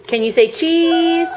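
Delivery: high, slow coaxing ask; mother coaxing her toddler to say cheese, high and slow; slow baby talk. Acted. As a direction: stretch it out sweetly and high.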